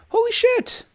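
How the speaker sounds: very high-pitched stunned reaction; astonished, voice shooting up; real shock. Real, not acted. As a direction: gasp it out, genuinely floored. Reacts to a viewer's donation.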